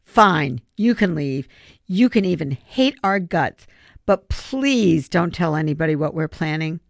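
ordinary delivery, nothing marked

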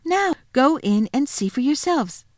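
put-on high, triumphant child's cry. Lucy opening the wardrobe for her siblings, crying out. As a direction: urge them on in a high voice. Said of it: "and cried".

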